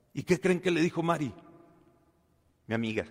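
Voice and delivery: ordinary delivery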